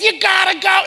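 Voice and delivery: high, loud pep-talk shout; upbeat urging. Retold or imitated. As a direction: push them on with hammed-up zeal. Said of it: "He was, like, real upbeat".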